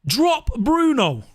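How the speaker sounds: slow, stunned echo of the words; incredulous repeat. Real, not acted. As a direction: repeat it like you can't believe it.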